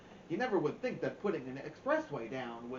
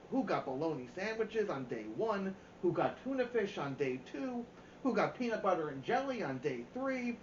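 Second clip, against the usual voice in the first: ordinary delivery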